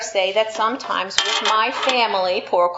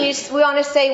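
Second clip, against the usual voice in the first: normal talking voice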